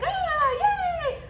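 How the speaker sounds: high, bright mock cheer; fake cheer. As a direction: squeal a fake happy yay. Mock social media cheer.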